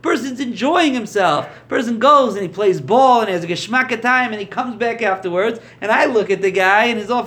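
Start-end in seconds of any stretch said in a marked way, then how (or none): none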